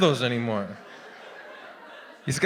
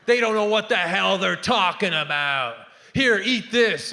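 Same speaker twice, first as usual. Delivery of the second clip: lightly voiced scornful healer, pitched up; dismissive naturopath; 2 semitones higher than usual. Naturopath rubbishing the patient's doctor, dismissive scorn. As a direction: wave the doctors away, then push the remedy. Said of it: "She goes".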